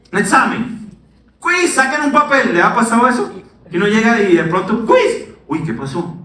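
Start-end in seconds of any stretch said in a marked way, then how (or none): 1.4-2.5 higher bossy teacher announcing a test; mock teacher. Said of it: "el profesor dice". 4.9-5.3 very high squeaky teacher call; mock teacher. Teacher springs surprise quiz.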